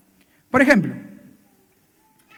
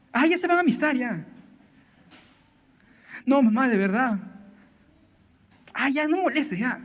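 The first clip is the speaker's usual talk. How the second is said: dismissive mother; 3 semitones higher, 4 dB quieter than usual. Retold or imitated. Mother mocking daughter's problem.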